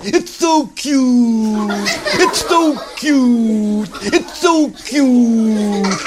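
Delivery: high, slow cooing over the bunny; cooing girls. Acted. Girls cooing at a baby bunny, high and slow. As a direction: melt over something adorable. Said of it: "all the girls said".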